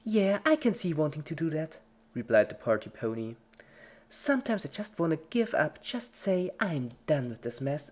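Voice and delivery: high, loud and preachy; chirpy pep talk to a dying friend; creepy cheerful Pinkie. A narrator's reading. Pinkie lecturing the victim.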